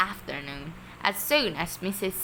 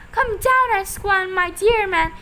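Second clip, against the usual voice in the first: high, loud thrilled cry; excited cry; 12 semitones higher, 9 dB louder than usual. A narrator's reading. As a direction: cry out in delight. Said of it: "Missus Silver answered".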